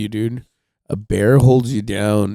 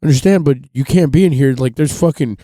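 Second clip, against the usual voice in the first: higher, louder ranger laying down the danger; park ranger warning; 5 semitones higher, 5 dB louder than usual. Retold or imitated. Ranger warns him about the bears, raised voice. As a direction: scare the kid off the trail. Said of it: "And he goes".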